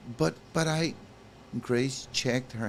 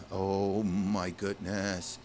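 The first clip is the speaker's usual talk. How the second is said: normal talking voice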